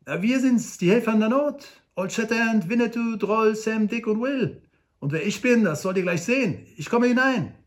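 high, proud little helper voice; eager little Frank. Acted. Hobble Frank proudly tells the trapped men who came, high eager voice. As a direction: announce the rescuers proudly. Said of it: "Das stets hilfsbereite Kerlchen".

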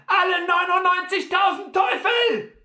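high, loud outburst of rage; furious shout. A narrator's reading. Butler curses after being thrown off the mule, furious shout. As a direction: yell the curse at full force in fury. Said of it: "schrie er wütend".

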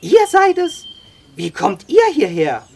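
high, loud incredulous question; astonished. A narrator's reading. Sam finding the boy on the horse, astonished. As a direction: blurt it out in amazement.

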